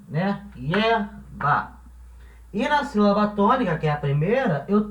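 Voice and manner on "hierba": Hierba broken in two, HIER, pause, BA; two separate beats for Hierba, with a gap before BA; split into syllables. Shown as a demonstration. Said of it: "A sílaba tônica é a primeira".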